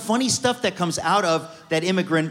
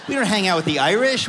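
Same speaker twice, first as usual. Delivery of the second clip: normal talking voice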